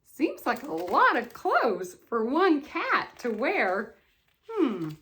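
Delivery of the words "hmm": plain reading voice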